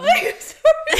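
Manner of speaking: high, loud, laughing through words; laughing apology. Real, not acted. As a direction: apologise while still cracking up. Laughing helplessly.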